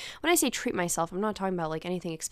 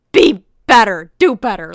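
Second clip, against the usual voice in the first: much louder stern telling-off; scolding; 15 dB louder than usual. Retold or imitated. Telling off Jackie for being rude to the pharmacist, loud and stern. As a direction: scold her like a fed-up parent. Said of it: "but I'm like".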